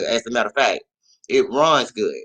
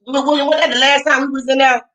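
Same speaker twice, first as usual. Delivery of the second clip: puzzled question; 11 semitones higher, 3 dB louder than usual. Retold or imitated. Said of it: "I noticed".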